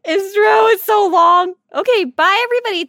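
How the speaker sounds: high, loud cheery goodbye; excited loud sign-off. Real, not acted. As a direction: wrap up the show with gusto.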